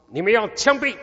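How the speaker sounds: loud, high Cantonese-accented Mandarin; a Cantonese speaker's Mandarin, loud and high. Shown as a demonstration. Pastor shows congregation Cantonese-accented Mandarin, loud and high. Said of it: "我讲一句话给你听好不好？仔细听哦".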